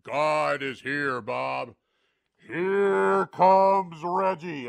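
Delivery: slow, loud divine boom; booming voice of God. Retold or imitated. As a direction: proclaim it like God from above. God's voice waking Bob.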